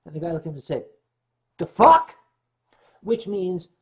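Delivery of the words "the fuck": loud, high New York bark; New Yorker baffled by the answer; New York tough guy. Retold or imitated. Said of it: "New Yorkese".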